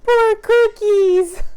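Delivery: high, slow mock pity for broken cookies; mock sad. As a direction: pretend to mourn the cookies, drawn out. Sees broken cookies in box.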